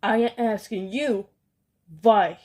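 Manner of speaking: loud furious demand swelling on why; scream. Acted. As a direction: scream why right in their faces. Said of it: "she screamed".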